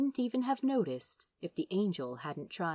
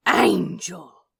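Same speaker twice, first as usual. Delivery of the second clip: exasperated shout; 10 dB louder than usual. Acted. Crowley shouts protest.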